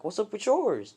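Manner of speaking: high-pitched comeback; indignant retort. Retold or imitated. Deku answering Bakugo in a quarrel, indignant retort. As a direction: throw the question back, pitch soaring on the end. Acted quarrel, throwing it back.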